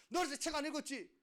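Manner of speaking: high, loud, fast accusation; accusing teacher. Acted. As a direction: fire the question like a scolding teacher. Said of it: "선생님들이".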